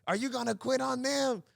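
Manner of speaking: high-pitched imitation of a coach; coach challenging. Coach challenges Mike about quitting, mock tirade. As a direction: goad the player like a hard-driving coach. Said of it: "that's our coach would talk like".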